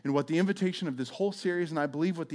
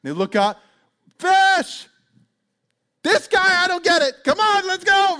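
much higher, louder calling out; excited shout; 10 dB louder than usual. Retold or imitated. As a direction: yell to the partners across the water. Partner shouting to other boat.

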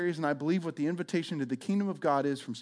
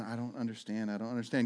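softer, lost repetition; bewildered and stuck; confused muttering; 5 semitones lower, 9 dB quieter than usual. Retold or imitated. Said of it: "sitting there going".

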